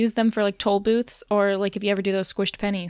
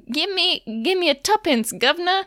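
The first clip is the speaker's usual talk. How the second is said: high, hammed-up British street voice; badly faked British "governor" accent; 7 semitones higher than usual. Shown as a demonstration. Podcaster attempting an example of British speech, high and badly faked British accent. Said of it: "That was not a British accent".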